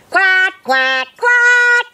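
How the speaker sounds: three high quacks climbing to a peak; whooped duck quacks. A narrator's reading. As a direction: celebrate with rising, squeaky-high quacks. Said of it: "whooped Quentin".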